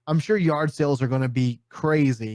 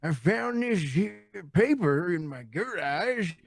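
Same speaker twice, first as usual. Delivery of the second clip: put-on old Tennessee man; 5 semitones higher than usual. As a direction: play an elderly Tennessee man showing off his find. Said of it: "ninety-seven-year-old man from Tennessee".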